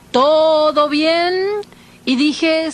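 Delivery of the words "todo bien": slow, drawn-out watch cry; lookout's call from the stern. Retold or imitated. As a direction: echo the call along the ship. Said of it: "Y allá en la popa". (grito alargado de vigía desde la popa)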